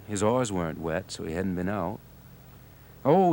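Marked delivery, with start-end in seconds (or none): 3.0-3.3 high, loud sudden oh; light up at the thought; a high, loud burst of recollection; sudden recall. A narrator's reading. Charlie remembers the diamonds.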